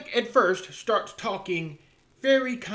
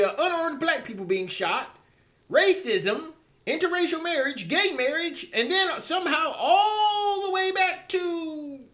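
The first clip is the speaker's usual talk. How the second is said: high-pitched, incredulous listener's protest; exasperated disbelief; 10 semitones higher than usual. Acted. Acting a baffled listener questioning a pundit's leaps, exasperated. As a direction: climb high in bafflement, dragging out the last leap.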